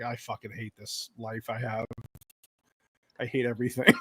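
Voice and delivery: hushed, low thought voice; mock inner despair. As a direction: mutter it softly, as if staring into space. Voicing husband's thoughts, staring into space.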